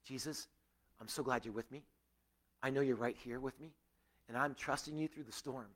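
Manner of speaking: hushed and trusting, softly acted; calm, trusting prayer. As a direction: pray it quietly, as if safe in the storm. Acted calm prayer amid trouble.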